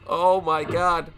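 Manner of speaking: ordinary talk, nothing marked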